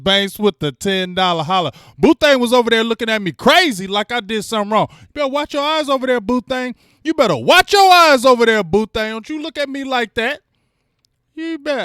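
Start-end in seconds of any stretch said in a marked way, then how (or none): 4.9-11.8 playful high warning; mock stern teasing; mock scolding. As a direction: pretend to scold a friend. Teasing a viewer.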